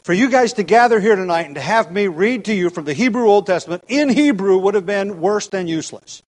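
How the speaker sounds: preaching with force. Acted. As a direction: preach it out, pressing hard on the congregation.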